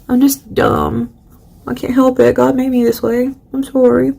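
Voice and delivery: high, put-on humble voice; mock helpless apology. Poet excusing her poems to critics, mock apology.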